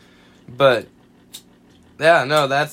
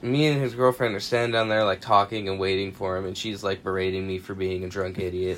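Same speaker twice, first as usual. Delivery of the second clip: usual speaking voice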